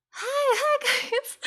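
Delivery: imitating a streamer's put-on girl voice; imitated girl voice. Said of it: "it's like, girl voice".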